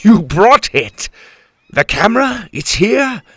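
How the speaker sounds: high, loud, eager gasping questions; can barely believe the prize is here; pirate captain thrilled at the camera; excited. Acted. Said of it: "The captain's eye lit up".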